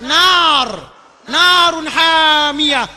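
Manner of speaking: very slow, loud, stretched Arabic; drawn-out verse. Acted. Preacher stressing the blazing fire, slow and loud. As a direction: stretch every syllable.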